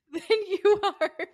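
high, loud laugh through the words. Real, not acted. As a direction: break up laughing as you say it.

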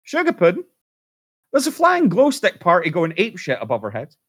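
high, loud squeaky wife voice. Retold or imitated. Wife sees flashing craft through binoculars, squeaky voice. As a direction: squeal it like an excited housewife. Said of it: "So she goes".

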